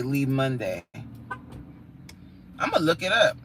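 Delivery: ordinary delivery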